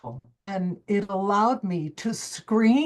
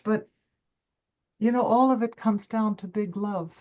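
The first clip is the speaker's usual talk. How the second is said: normal talking voice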